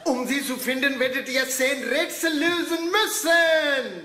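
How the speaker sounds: slow, mysterious host voice; theatrical riddle-master narration; dramatic game announcement. Acted. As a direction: lay out the quest like a treasure-hunt master. Host reads out the game rules.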